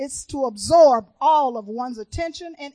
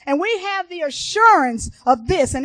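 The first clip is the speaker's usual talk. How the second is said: loud, high, emphatic testimony; full-force preaching; 5 semitones higher, 9 dB louder than usual. Real, not acted. Preacher testifying that the songs ministered to her, full force. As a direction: belt it out to the whole church, hitting every beat.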